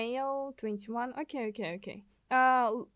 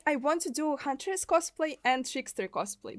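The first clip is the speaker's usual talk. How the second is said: ordinary talk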